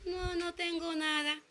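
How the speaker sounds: soft, slow, dispirited denial; downcast reply. Retold or imitated. A friend on the phone, denying it in a soft dispirited voice. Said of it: "en ese tono de voz se escucha el desánimo".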